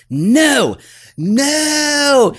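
sharp high mock shout of no; harsh shouted no no at the dog; retold yell. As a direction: imitate the guy yelling at his dog. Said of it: "and he goes".

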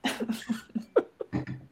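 silent wheezing laugh; high unvoiced giggle fit; breathless high laugh. Real, not acted. Laughing at cucka fork.

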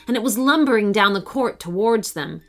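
usual speaking voice

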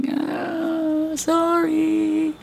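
high squeaky sorry; mock the guilty kid apologizing; sheepish apology. Caught child apologizing to mom in a high squeak. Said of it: "And you're like".

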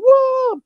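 a big hopeful crowd whoa; crowd cheer. Retold or imitated. Band expecting the crowd's cheer, mock whoa. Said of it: "waiting for the crowd to go".

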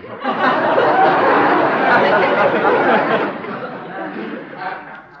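long rolling high belly laugh; loud hearty guffaw; booming laugh. Acted. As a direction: throw back the head and roar with laughter. Pompous man's big laugh.

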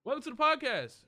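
sudden loud intro yell; loud shout. Real, not acted. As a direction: burst out loud welcoming listeners. Loud intro after whisper bit.

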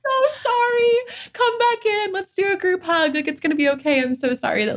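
high cooing comfort, easing down gradually; acted tender apology. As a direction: coo an apology to a hurt child. Acting out comforting one's past self.

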